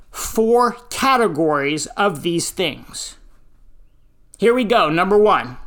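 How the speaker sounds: normal talking voice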